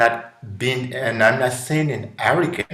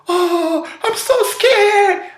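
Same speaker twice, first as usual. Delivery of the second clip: put-on much higher, slow, playful childlike fright; mock scared child; 6 dB louder, slower than usual. Child on a roller coaster, mock scared squeal. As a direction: play a kid pretending to be scared on a ride. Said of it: "child in a roller coaster".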